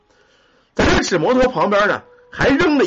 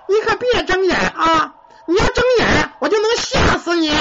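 put-on ghost child; 12 semitones higher than usual. Ghost child at a sleeping man's ear, eerie high kid voice. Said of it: "说话呢是一个小孩儿的声音".

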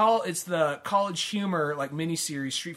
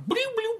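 high bubbly bloop sounds; cartoony bloop. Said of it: "they have a cartoony sound".

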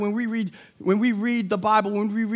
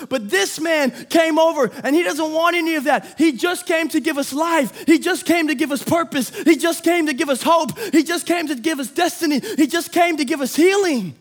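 higher, ringing sermon cadence over music; full-force preaching; 5 semitones higher, 5 dB louder than usual. Acted. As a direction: preach it like a rising refrain.

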